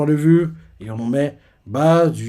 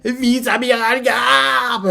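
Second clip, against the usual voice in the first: much higher, slow weeping shout; tearful cry; 10 semitones higher, much slower than usual. Retold or imitated. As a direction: call out through tears. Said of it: "Les larmes aux yeux". (cri en larmes, lent et aigu)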